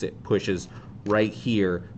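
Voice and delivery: ordinary delivery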